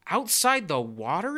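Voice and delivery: slow, high, rising question; astonished exclamation. A narrator's reading. Tadpoles baffled by a world beyond their water, astonished exclamation. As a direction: stretch it out in disbelief. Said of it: "exclaimed the tadpoles".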